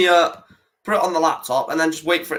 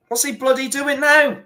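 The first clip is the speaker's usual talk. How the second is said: exasperated outburst; 8 semitones higher than usual. Real, not acted. Angry at the referee.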